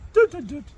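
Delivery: high sung doo doo doo, falling; imitated dishwasher beep. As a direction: sing the dishwasher switching off. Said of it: "it goes".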